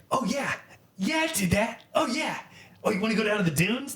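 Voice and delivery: mock the kid's laid-back chant up high; imitating Tyler. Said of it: "He'd be like".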